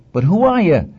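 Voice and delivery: high, insistent challenge; doubting Moses, pitched well up; questioning Moses. Acted. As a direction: press the question with a big pitch jump. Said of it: "And Moses said".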